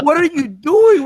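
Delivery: high and loud, startled; shocked girlfriend. Retold or imitated. Host voicing his girlfriend on the phone, high and loud in shock. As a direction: fire it off in disbelief. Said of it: "She's like".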